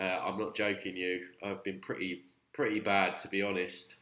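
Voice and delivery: ordinary delivery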